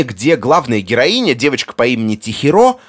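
normal talking voice

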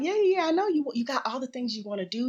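high, quick imitation of the nurse; brisk dismissal. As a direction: wave away the excuses, starting way up high. Mimicking nurse brushing off her busyness.

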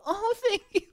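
high, laughing thank-you; a squeaky surprised thanks; mock surprised thanks. Swallowing the gum's burst, high surprised thanks. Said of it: "and you go".

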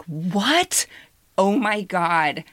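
ordinary talk

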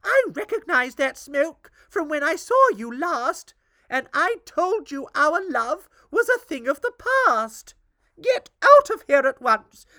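high, loud angry scolding. Acted. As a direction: drive the witch out furiously. Queen furiously banishes the witch.

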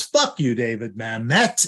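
overemphasize every syllable; stilted, over-stressed amateur read. Retold or imitated. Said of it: "every possible syllable is being highlighted".

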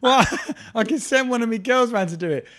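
loud, high and laughing; over-the-top bribe offer, laughing; mock sleazy promoter. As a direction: ham up a sleazy fixer offering a favour. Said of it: "It's like".

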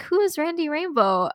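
puzzled dad. Retold or imitated. Daughter imitates her dad asking who [name] is, lightly puzzled. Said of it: "my dad was like".

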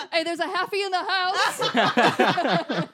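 laughing cheer. Pretend. Mock entrance cheer, laughing.

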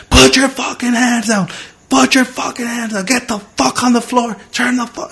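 high, strained cop yell at ordinary volume; mock shouted police commands; imitated yelling. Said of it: "he's yelling something at me".

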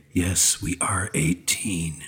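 slow, drawn-out phone-line recording; adult chat-line voice. Retold or imitated. Co-host imitating one of them lines, slow hotline voice. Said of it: "one of them lines where they say".

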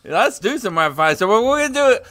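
ordinary talk

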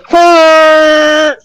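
sharp high shouted huh, hammed up; mock driver's shout. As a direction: bark it back like a rude driver. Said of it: "And then he goes".

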